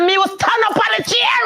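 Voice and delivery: high mock scream at her son. Mother yelling at her tall son over prom, high laughing scream. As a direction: yell it, pitch way up and laughing. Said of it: "And I'm screaming like that".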